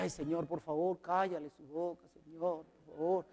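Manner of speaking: a soft, low, weary plea to the Lord, half asleep; pleading prayer. Retold or imitated. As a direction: beg quietly, worn out from the night. Tired prayer at night, sick mother. (súplica cansada y baja)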